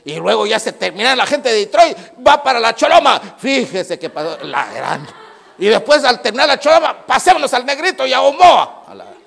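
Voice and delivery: high, loud, building mock shout; gossip spreading loud. Pastor mocking gossips to the church, loud shouting. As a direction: hammer out how the gossip races town to town.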